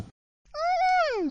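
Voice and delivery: tiny high joyful squeak; happy squeak. Acted. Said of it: "sie quiekt ganz leise und freudig".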